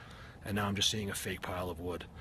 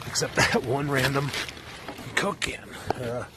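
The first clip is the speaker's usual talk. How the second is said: breathless, panting between words; winded from the hike climb; out of breath; 4 semitones higher, 3 dB louder than usual. Real, not acted. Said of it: "sorry, I'm out of breath".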